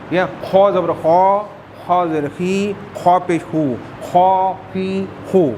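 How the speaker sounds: خو زبر خو spelled out slowly with a rasping kh; slow letter drill. Shown as a demonstration. Teacher drilling خ with vowel marks. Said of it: "تیسرا حرف خو".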